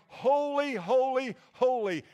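very high, loud cry of holy; soaring angelic shout; angels crying out. Retold or imitated. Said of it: "subservient angels crying".

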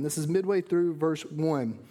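ordinary delivery

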